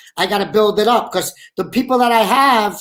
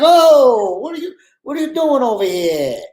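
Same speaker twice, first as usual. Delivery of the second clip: startled alarm; 5 semitones higher, 4 dB louder than usual. Retold or imitated. Gun pointed at him in scene.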